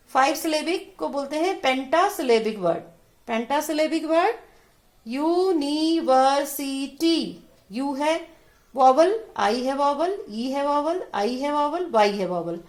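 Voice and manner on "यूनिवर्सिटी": "यूनिवर्सिटी" very slow and higher, five separate beats; syllable-by-syllable demo. Shown as a demonstration. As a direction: stretch each syllable of "यूनिवर्सिटी", falling at the end. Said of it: "अलग-अलग कर दिया".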